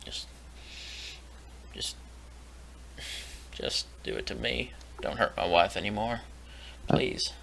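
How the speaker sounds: quiet desperate plea; pleading softly. Acted. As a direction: beg them in a hushed voice. Captive begging for wife.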